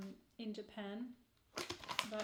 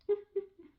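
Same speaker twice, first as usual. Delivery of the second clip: laugh; 9 semitones higher, 7 dB louder than usual. Real, not acted. Laughs while tasting cake.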